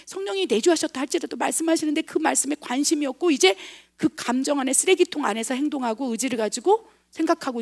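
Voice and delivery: normal talking voice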